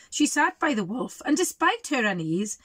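ordinary delivery, nothing marked

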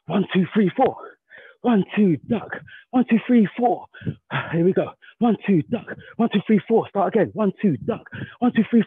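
rhythmic combo count, punchy; boxing coach calling combos. Shown as a demonstration. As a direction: call each punch sharply. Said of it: "I've got the first combination".

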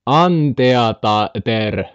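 Anteater stretched very slowly, syllable by syllable; drawn-out Finnish reading. Pretend. Streamer reading the animal name Anteater, slow Finnish spelling pronunciation.

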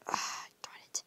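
hushed, breathy frustrated curse; whispered darn it. Acted. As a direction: curse under your breath to yourself. Muttered frustration to himself.

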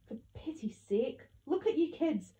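building from slow reproach to high, loud cry; exasperated exclaiming. A narrator's reading. Gran chiding kids on Christmas, exasperated and rising. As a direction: start weary, then climb high and loud. Gran chides the gloomy children.

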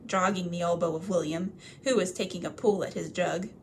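usual speaking voice

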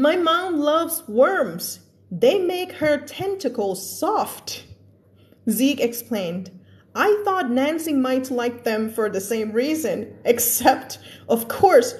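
slow storybook read; slow story reading. A narrator's reading. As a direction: read it slowly to the kids. Reads story aloud to class. (อ่านนิทานช้าๆ)

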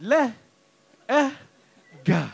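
"Le, Eh, gah" as separate letter sounds; sounded out slowly. Retold or imitated. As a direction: imitate a child sounding out "Le, Eh, gah", pausing between sounds. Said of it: "She's just saying it slow".

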